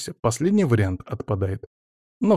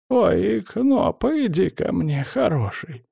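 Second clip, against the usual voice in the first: high, tender calling to a cat; granny calling her cat; cooing granny; 9 semitones higher, much slower than usual. A narrator's reading. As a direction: coo to the cat like a grandma.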